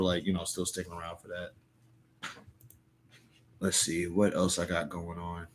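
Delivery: ordinary delivery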